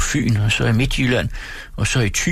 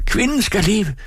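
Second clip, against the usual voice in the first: put-on high-pitched drunken toast, "Kvinden" shot up high; screaming; 13 semitones higher than usual. A narrator's reading. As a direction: scream "Kvinden skal leve!" with the first word pitched high. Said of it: "mens han skreg".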